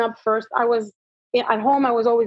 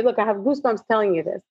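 ordinary talk

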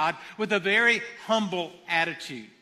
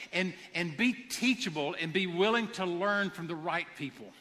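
ordinary talk, nothing marked

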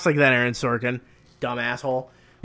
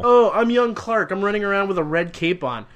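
mock young Clark; 6 semitones higher, 3 dB louder than usual. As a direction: sneer at the hero's posing. Mocking the young Clark scene.

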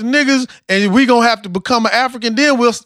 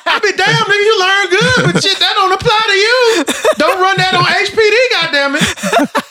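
laughing scold; 7 semitones higher than usual. Real, not acted.

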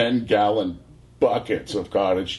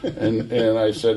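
normal talking voice

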